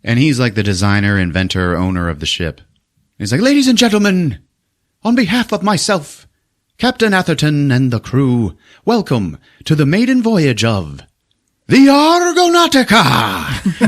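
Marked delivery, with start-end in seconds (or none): 3.4-13.8 much higher, louder pompous announcement; mock grand speech. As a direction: announce it like a showman host. Ship owner's grand speech.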